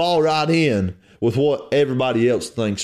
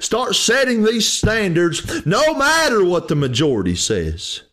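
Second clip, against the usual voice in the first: raised, driving exhortation, higher and pressing; preacher urging fathers, mothers and grandparents to set the standard, fervent and raised; fiery preaching; 9 semitones higher than usual. Acted. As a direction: hammer the call home to the congregation.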